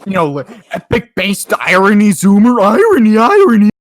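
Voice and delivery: loud, sneering parody of an ironic zoomer; mock the edgy kid, loud; a loud smug troll parody. Mocking zoomer irony talk.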